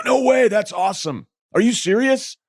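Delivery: high, loud burst of delighted disbelief; thrilled landlord. Retold or imitated. Landlord hearing of the Red Sox job, thrilled. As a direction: squeal it like a thrilled fan. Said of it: "And he was so happy".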